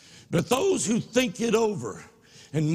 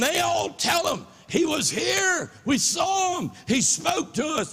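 excited shout; 9 semitones higher than usual. Acted. Disciples shouting the news to Thomas, excited. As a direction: burst out with the news.

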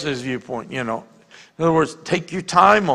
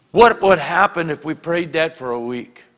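normal talking voice

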